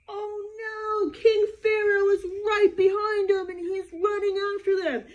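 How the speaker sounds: loud, high, panicked storyteller's cry; alarmed. Acted. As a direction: gasp and shout the danger to the kids. Narrator gasps at Pharaoh chasing.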